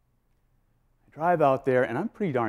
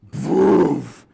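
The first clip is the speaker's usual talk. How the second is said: whoosh of canopy; 3 semitones higher, 8 dB louder than usual. Retold or imitated. Parachute opening sound.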